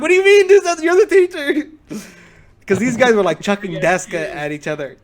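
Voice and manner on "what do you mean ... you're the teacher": high, loud disbelief breaking into laughter; what do you mean, much higher and louder, laughing; incredulous student. Retold or imitated. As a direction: laugh in his face, can't believe it. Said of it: "the student looks at him like".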